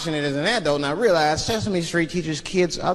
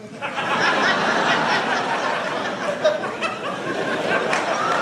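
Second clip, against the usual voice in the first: wheezing airy giggle much higher; real laugh at own joke; high breathless laugh. Real, not acted.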